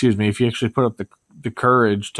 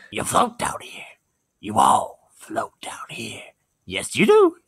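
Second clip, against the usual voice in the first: Pennywise voice; slower than usual. Pretend. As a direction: hiss it slowly, then a shrill clown laugh. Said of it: "what else did Pennywise say".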